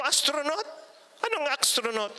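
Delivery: high, puzzled echo of the word; puzzled surprise. Retold or imitated. Speaker asking the crying mother back, high and puzzled. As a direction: ask back in disbelief. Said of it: "Sabi ko".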